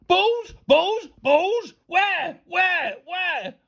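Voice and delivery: high, loud repeated demands; frantic shouting. A narrator's reading. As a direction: fire each word out in a panic. Teacher frantically hunting imaginary balls.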